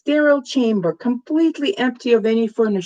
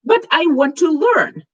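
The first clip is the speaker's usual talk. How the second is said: eager; 7 semitones higher, 3 dB louder than usual. A narrator's reading. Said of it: "a gleam of intensity".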